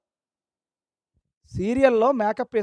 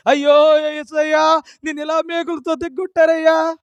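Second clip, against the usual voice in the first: mock wailing; 5 semitones higher, 5 dB louder than usual. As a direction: wail mockingly like a grieving believer. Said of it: "వీళ్ళు ఏడుస్తున్నాడురా". (గొంతు పెంచి సాగదీసిన ఏడుపు అనుకరణ)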